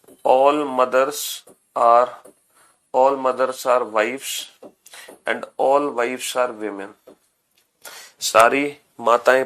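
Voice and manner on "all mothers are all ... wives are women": each word drawn out for note-taking; very slow dictation. Shown as a demonstration. Teacher spelling out the rule.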